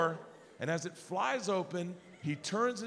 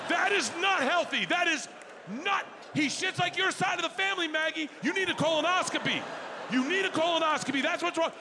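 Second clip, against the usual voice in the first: furious cursing dad; 7 semitones higher than usual. Retold or imitated. Dad raging at son and wife Maggie, high furious rant. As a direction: blow up at your son's bowels and the whole family. Dad ranting in escape room.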